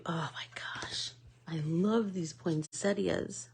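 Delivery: slow, hushed breath of delight; awed whisper. Real, not acted. Showing off her poinsettias, a slow awed whisper. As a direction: whisper it like you can't believe how pretty it is.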